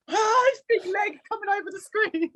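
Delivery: high, squealing mock panic, hammed up; playful mock alarm at her own clumsy dancing. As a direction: squeal it like you're watching in horror. Imagined panicked reaction on set.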